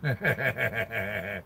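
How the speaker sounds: low, rapid chuckle. Real, not acted. Streamer amused by his live chat, low chuckle. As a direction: chuckle to yourself, low in the throat.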